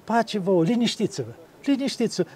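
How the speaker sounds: soft, hushed reassurance; calm the frightened ones down; soothing calm. Retold or imitated. Hristos liniștind apostolii speriați, încet și blând.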